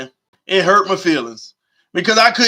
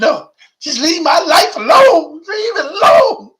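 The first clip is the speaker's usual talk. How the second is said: high, slow, yelling fake sob; hammed-up crying outburst; mock tearful shout; 9 semitones higher, 4 dB louder than usual. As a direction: wail it like a dramatic addict refusing rehab. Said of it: "I can't take rehab".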